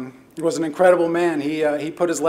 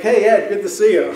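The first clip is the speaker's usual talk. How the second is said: laughing quote; 3 semitones higher than usual. Retold or imitated. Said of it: "and he'd be like".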